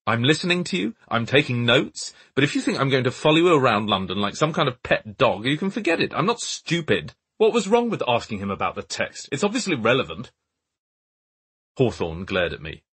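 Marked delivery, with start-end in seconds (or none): 0.0-7.1 high, loud angry tirade; fed up and shouting; furious. A narrator's reading. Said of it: "Right then, I'd really had enough". 7.4-10.3 defensive. A narrator's reading. Narrator arguing with Hawthorne.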